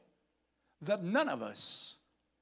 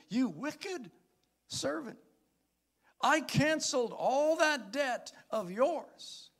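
high, sharp rebuke; a stern master's high cry; scolding master; 12 semitones higher, 5 dB louder than usual. Acted. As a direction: condemn the wicked servant. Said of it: "God said to him".

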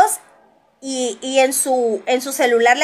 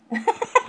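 sudden high-pitched laugh; real laugh breaking out; high burst of laughter; 9 semitones higher, 5 dB quieter than usual. Real, not acted.

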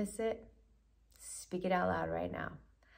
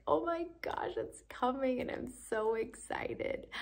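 put-on much higher, giddy squeal of a thrilled child; childlike excitement; 7 semitones higher than usual. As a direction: gush like a kid on Christmas eve. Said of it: "feel that childlike excitement".

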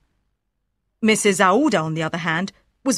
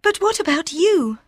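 much higher, gentle female voice; tender young woman; 8 semitones higher than usual. A narrator's reading. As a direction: Aouda reassuring Fogg. Aouda voice.

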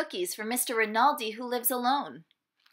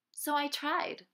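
plain reading voice

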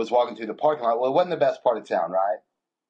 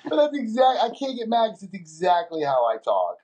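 ordinary talk, nothing marked

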